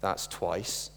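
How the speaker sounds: low, soft, calm warning; quietly. Acted. Wife in the joke warning her stumbling donkey, quiet and calm. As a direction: say it softly, a quiet threat. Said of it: "My wife said quietly".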